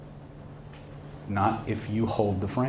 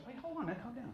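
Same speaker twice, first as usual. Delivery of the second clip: very soft, higher-pitched placating; suddenly small voice, soothing the other man; taken-aback backdown; 12 semitones higher, 18 dB quieter, much faster than usual. Acted. Angry bodybuilder now calming the ranting clerk, soft taken-aback plea.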